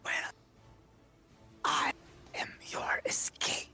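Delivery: whispered, high demonic entity. Acted. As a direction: breathe it like an ancient demon. Demon voice held.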